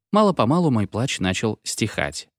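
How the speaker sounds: usual speaking voice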